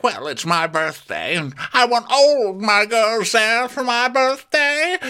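high, slow mock diva voice; squeaky put-on demand; imitating Karen. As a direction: play the spoiled birthday girl. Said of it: "she's like".

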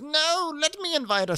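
very high mock voice; high hammed-up interruption; imitating Karen. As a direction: insist in a squeak. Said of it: "Karen goes".

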